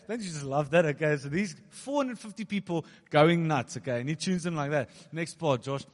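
normal talking voice

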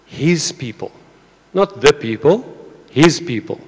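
HIS people, with the word his leaned on; preacher stressing his in his people. Shown as a demonstration. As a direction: lean on his each time in his people. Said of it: "You speak about".

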